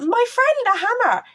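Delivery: put-on high, bright childlike voice; innocent chirp. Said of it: "I was like".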